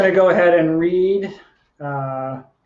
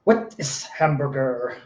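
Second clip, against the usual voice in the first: "What is hamburger?" slowly, every word set apart; slow, careful question; 3 dB quieter, slower than usual. Acted. Poet posing the poem's question, slow and deliberate. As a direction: ask it slowly with HAMBURGER fully pronounced.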